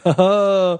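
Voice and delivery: a spoken, kidding ha-ha; joking way. Retold or imitated. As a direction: sheepishly joke it off. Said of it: "in kind of, like, a joking way".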